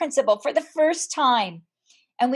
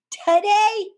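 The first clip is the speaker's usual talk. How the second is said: excited; 8 semitones higher, 5 dB louder than usual. Retold or imitated. Mom's excited announcement to child.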